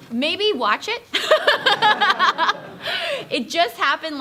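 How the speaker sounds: high, loud laughing aside. Real, not acted. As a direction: burst into laughter on it. Laughs at her own suggestion.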